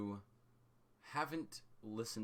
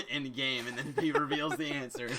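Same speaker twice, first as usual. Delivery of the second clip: laughing through the words; 5 semitones higher, 9 dB louder than usual. Real, not acted. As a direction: giggle while explaining. GM laughs at trick.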